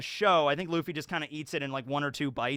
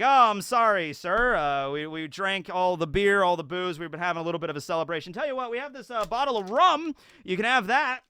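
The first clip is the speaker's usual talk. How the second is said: louder, lightly acted placating host; mock jovial apology; 3 semitones higher, 6 dB louder than usual. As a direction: play an over-friendly apology, lifting in pitch. Shanks placating the bandit at bar.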